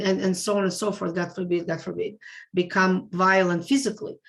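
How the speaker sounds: normal talking voice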